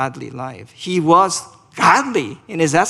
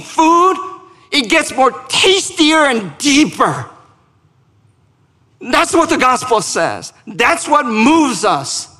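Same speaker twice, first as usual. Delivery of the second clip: high, driving pulpit delivery; full-force gospel proclamation; impassioned preaching; 8 semitones higher, 6 dB louder than usual. Real, not acted. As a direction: proclaim it with rising fervour, punching key words.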